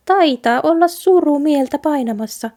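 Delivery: a small mouse gently prying; little mouse voice. A narrator's reading. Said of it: "hiiri virkkoi".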